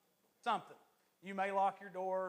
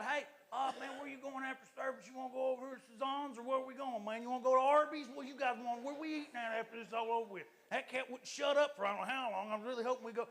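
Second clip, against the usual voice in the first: higher-pitched run-on chatter; imitating chatty churchgoer; 3 semitones higher than usual. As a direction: prattle on like a gossiping congregant. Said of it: "being a chatty Cathy".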